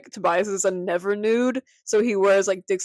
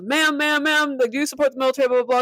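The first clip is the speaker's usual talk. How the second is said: higher, quick pestering quote; insistent accosting; 6 semitones higher, 4 dB louder, faster than usual. Retold or imitated. Retelling someone stopping the mother, lightly acted pestering. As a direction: mimic someone flagging down a ma'am.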